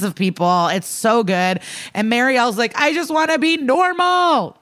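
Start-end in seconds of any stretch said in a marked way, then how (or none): 2.8-4.6 mock wail. Marielle crying out to her mother, mock wail. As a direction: wail it in a much higher voice, hammed-up. Acted wail of upset daughter.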